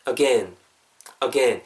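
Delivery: "again" in two syllables, "uh-gen"; recommended pronunciation. Shown as a demonstration. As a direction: say "again" with a short e, falling. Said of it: "이렇게 발음해 주시면".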